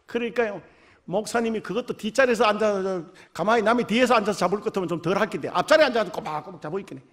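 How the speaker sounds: ordinary delivery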